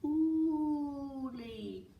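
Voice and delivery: distant echoing call, high. Acted. Forest echoes back brothers' call, faint distant echo. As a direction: let it ring back like an echo. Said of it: "the forest echoed back".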